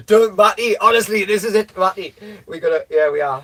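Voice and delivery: loud, high and worked up; rally a reluctant mate after laughing; excited insistence. Real, not acted. Rallying friend for hike, laughing.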